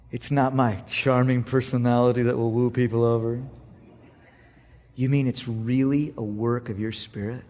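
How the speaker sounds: low, soft dawning realization; hushed, halting prayer; humbled prayer. Retold or imitated. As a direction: quietly question God with humility. Retold humbled prayer that night.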